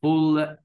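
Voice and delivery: "فول" alone as one held beat; "فول" isolated and sustained; third syllable demo. Shown as a demonstration. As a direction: sound out "فول" separately on a level tone. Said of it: "أدي تلات مقاطع".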